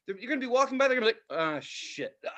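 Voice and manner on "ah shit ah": mock dejection. Acting out idle workers' dejection.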